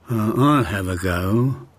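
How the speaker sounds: low, unhurried tortoise voice. A narrator's reading. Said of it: "said the tortoise".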